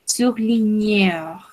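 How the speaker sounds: slow "Surligneur", GN sounded as ñ. Shown as a demonstration. Teacher demonstrates letter combination GN, slowly as ñ. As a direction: draw out "Surligneur" with a clear ñ for GN. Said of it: "la pronunciamos como una ñ".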